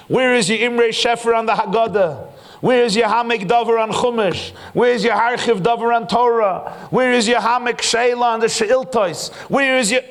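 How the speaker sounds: high, loud, insistent chant of questions; demanding outcry. Acted. God acted, demanding book after book.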